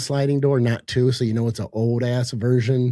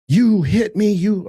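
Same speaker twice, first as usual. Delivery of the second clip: retold hysterical yell; 8 semitones higher, 4 dB louder, slower than usual. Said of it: "he's hysterical".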